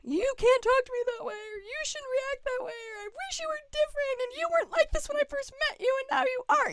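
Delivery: high-pitched mock argument, complaints strung together; mock fighting. Partner picking a fight in an imagined quarrel, high mock complaining. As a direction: act out a partner's high, fast griping. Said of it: "when we wanna, like, fight".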